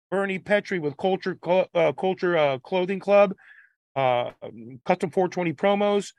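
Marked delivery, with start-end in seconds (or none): none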